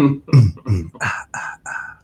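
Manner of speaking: breathy mock seductive moans. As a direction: moan it to tease, sultry. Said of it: "in my seductive voice".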